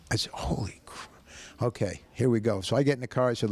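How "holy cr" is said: hushed mock oath, stopped short; cut-off whispered curse. Telling the story, he mock-curses under his breath, whispered. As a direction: whisper "Holy cr-" and bite it off. Retelling shock at the car's debt.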